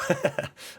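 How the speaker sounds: quick laugh at himself; short amused chuckle. Real, not acted. Laughs at his own avatar.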